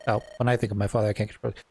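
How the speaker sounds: quick corrective reread. Acted. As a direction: rattle it off as a hurried retake. Rushed reread correcting the misread line.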